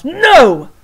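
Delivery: loud, high shouted no. Acted. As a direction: bellow the no. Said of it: "shouted the most very honored head mouse".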